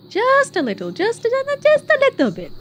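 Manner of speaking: overwhelmed by cuteness. Real, not acted. Cooing over puppy videos.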